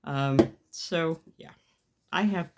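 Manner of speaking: normal talking voice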